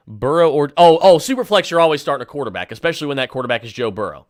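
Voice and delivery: ordinary talk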